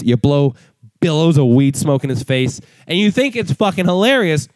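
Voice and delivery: ordinary delivery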